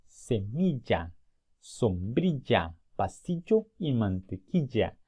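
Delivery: Semilla, sombrilla, pasillo y mantequilla spoken slowly, ll as a clear y sound; slow each word down so the class hears the ll; slow model pronunciation. Shown as a demonstration. Teacher modeling correct pronunciation slowly for students. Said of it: "Esas palabras se pronuncian así".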